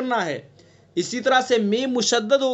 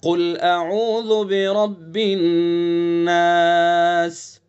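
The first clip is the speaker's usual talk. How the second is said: slow chanted tajweed recitation; Quran recitation; 4 semitones lower, much slower than usual. Shown as a demonstration. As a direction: draw out the nasal ghunna on नास. Teacher recites Quran with ghunna. (धीमी तजवीद वाली तिलावत)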